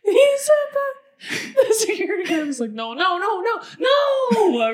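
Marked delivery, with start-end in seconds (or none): 2.7-4.7 panicked shouting. Retold or imitated. Security guard panicking at naked woman.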